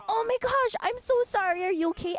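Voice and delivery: high, flustered retold apology; mock panicked apology. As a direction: imitate a man rushing over, alarmed. Man apologizing to knocked-down child.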